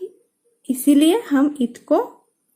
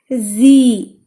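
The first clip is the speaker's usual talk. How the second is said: जी said louder, with a pressed stress; 2 semitones lower, 5 dB louder than usual. Shown as a demonstration. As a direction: give जी a firm stressed push. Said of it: "stress feel हुआ".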